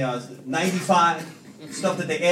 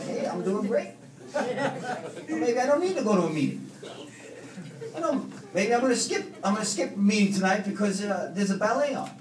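cocky newcomer voice; 5 semitones higher than usual. Retold or imitated.

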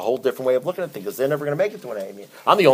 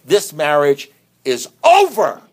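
mock declaration; 3 semitones higher, 6 dB louder, slower than usual. Client quitting marriage, quoted.

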